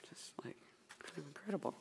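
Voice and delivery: hushed, awed whisper; whispered awe after the poem; whispered aside. Real, not acted. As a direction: breathe it out in amazement.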